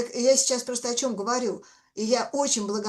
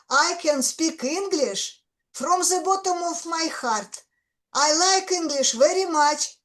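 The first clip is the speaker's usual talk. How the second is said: thick Russian accent, higher and louder; forceful Russian-accented English, pitched up; very strong Russian accent; 6 semitones higher, 5 dB louder than usual. Shown as a demonstration. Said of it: "a very strong Russian accent".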